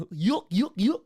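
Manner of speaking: dopey cartoon dog chuckle; high, rising goofy yucks. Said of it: "Goofy, I have, I could".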